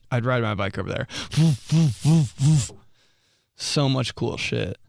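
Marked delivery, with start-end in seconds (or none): 1.4-2.7 dog barking. Retold or imitated. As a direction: bark like an excited dog.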